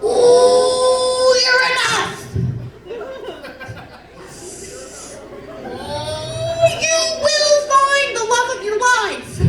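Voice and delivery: high crooning mock Reiki healer; dreamy Reiki lady. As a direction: croon like a new-age healer blessing you. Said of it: "She's just like".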